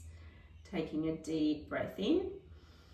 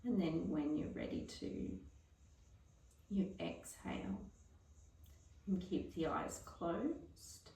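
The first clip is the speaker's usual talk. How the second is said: softer, fading guided relaxation voice; 6 dB quieter than usual. Acted. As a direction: talk them gently into stillness. Guided breath-hold relaxation.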